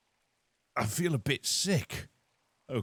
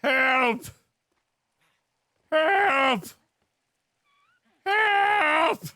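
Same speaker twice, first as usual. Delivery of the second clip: shouted cries for help, each higher and louder; calling for help; 14 semitones higher, 6 dB louder, much slower than usual. Acted. Locked in without keys, shouting for help, rising. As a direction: yell for rescue, climbing in pitch.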